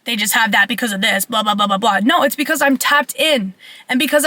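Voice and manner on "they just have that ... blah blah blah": low, fast mocking mumble of the doubters; lightly mocking what people think. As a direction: brush it off like the haters do. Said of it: "People wanna think".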